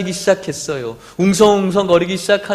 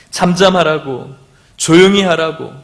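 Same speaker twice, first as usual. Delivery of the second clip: usual speaking voice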